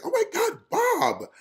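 squealing high starstruck fan; gushing fan. Retold or imitated. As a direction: gush at the star in a high squeal.

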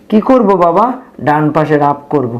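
ordinary talk

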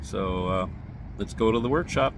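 normal talking voice

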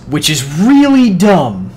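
sneering at a useless item; drawn-out disdain. Real, not acted. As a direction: stretch it out like it's beneath you. Complaining about the frost focus.

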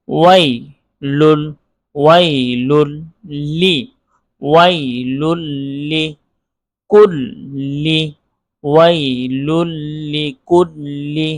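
WAI, LUL, LI spaced apart, then built up; each letter separately. Shown as a demonstration. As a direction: cumulative syllable drill with clear pauses. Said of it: "প্রত্যেকটা হরফের আলাদা আলাদা উচ্চারণ".

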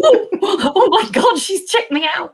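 high, laughing gasp of alarm; mock panic. Writer realising the FBI agent checked her, laughing mock panic. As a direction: replay the alarm an octave up, amused.

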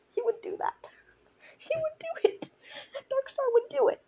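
high, squeaky words through laughter; helpless laughter. Real, not acted. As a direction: crack up while insisting. Cracking up at own joke.

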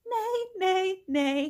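high whinnying neighs; high mock horse whinny. Horse sounds in farm book.

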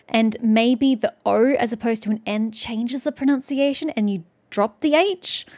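ordinary talk, nothing marked

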